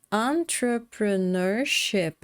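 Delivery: entrepreneurship stretched out slowly; slow model. Shown as a demonstration. Teacher counts five syllables for students, slow. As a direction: draw out entrepreneurship so they hear each part. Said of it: "veja bem".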